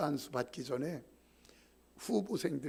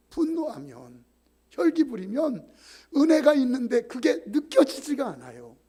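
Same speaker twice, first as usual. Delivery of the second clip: full-force preaching; 8 semitones higher, 13 dB louder than usual. Acted.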